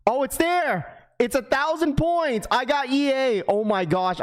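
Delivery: high excited rush. Real, not acted. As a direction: yelp with disbelieving joy. The drop finally loads.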